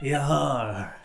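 soft comic pirate growl; drawn-out pirate interjection through amusement; lightly played pirate mobster; mafia pirate. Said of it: "combining the mafia voice with the pirate voice".

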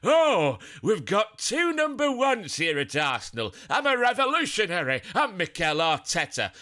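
lampoon the manager's pompous claim; mocking Mikel Arteta. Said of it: "Mikel Arteta has been saying".

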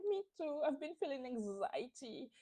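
high, teary mock whimper; mock crying. Candice tearing up to the housemates, mock teary. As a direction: imitate a tearful castmate, lightly. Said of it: "she starts crying because she's like".

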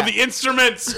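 sudden shout. Acted. Captain shouts.

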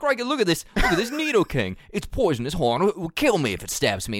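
excited Aussie wildlife host voice; Australian accent. Pretend. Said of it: "he's like".

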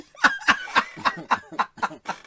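high-pitched giggling fit; real laughter. Real, not acted. Co-host cracking up at a crude comment, laughing. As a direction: crack up helplessly.

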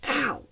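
high sudden ow; sharp yelp of pain. A narrator's reading. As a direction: yelp as if stung. Hand stung in the dark.

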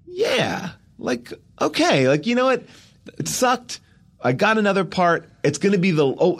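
high, bright-spirited self-quote; happy relief. Retold or imitated. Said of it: "And I kinda leave happy".

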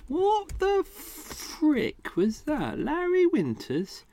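high, drawn-out disbelief; baffled. Real, not acted. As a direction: squeak it slowly, utterly puzzled. Baffled by own clip note.

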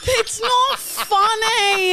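high, slow, half-laughing protest. Real, not acted. Teased host protests her hosts' joke, laughing. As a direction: whine it out through laughter.

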